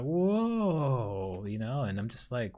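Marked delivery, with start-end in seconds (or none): 0.0-1.4 long, high whoa; mock awe. Onlookers just stuck after explosion, in mock awe. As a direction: imitate dumbstruck onlookers with a swelling whoa. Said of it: "awe may not be the best word".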